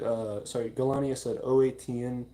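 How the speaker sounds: normal talking voice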